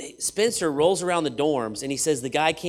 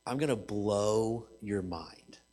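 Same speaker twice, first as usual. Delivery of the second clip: slow hushed tease; 7 semitones lower, 9 dB quieter, slower than usual. Acted. Preacher teasing the crowd before a reveal, slow and hushed.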